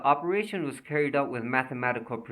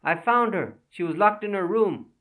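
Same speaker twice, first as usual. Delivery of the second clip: loud, higher call down the stairs; 8 semitones higher, 7 dB louder than usual. Acted. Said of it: "a detective called down".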